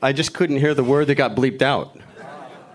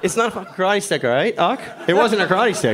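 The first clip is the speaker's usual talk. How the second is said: exasperated retort; 8 semitones higher, 3 dB louder than usual. Retold or imitated. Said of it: "I'm like".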